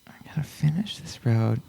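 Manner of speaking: whispered to himself; whispering. Real, not acted. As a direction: mull it over under your breath. Mutters the quote to himself.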